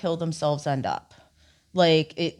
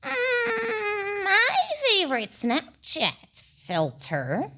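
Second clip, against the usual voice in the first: grandma voice; 13 semitones higher, much slower than usual. Acted. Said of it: "say it as a grandma".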